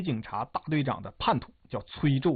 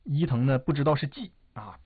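usual speaking voice

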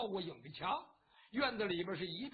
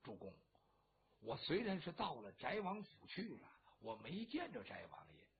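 much lower and softer, confiding; hushed secret report; 6 semitones lower, 8 dB quieter than usual. Acted. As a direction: lean in and keep it from being overheard. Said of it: "压低了声音就跟这李密说". (压低声音偷偷禀报)

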